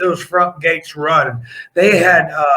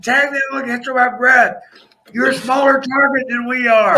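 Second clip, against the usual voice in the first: urgent shouting; 7 semitones higher than usual. Retold or imitated. Yelling to tag partner mid-riot.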